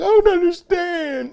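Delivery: high, slow, hammed-up grieving mother voice; mock bewildered mom. Mom after daughter's killing, hammed-up high bewildered voice.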